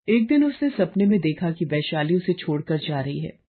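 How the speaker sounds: ordinary delivery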